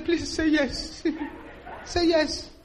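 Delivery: high pleading, half laughing; begging groom. Retold or imitated. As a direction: plead with her, voice lifted. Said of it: "the poor man was begging".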